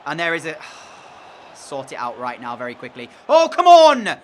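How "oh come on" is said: loud, high yell at the player; real angry outburst at the screen; furious shout. Real, not acted. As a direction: shout your frustration. Furious at a wasted touch.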